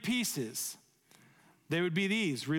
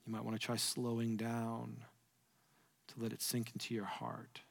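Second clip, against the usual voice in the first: soft prayerful guidance; 6 semitones lower, 7 dB quieter than usual. Real, not acted. Guiding quiet reflective prayer time.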